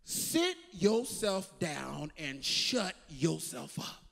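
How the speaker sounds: deep, slow rebuke; low and slow, put them in their place; stern voice of God. Acted. God rebuking a restless heart, deep and slow. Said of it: "the word of the Lord comes to us and says".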